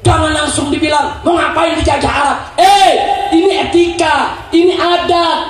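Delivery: high, insistent sermon rebuke; forceful preaching. Real, not acted. Preacher rebuking those who object to Arabic style, forceful preaching. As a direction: punch out each point to the crowd.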